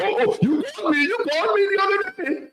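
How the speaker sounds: high, laughing mock whine; mocking imitation. Host laughing at guest's on-air jab, high mocking parody. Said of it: "He coulda just said".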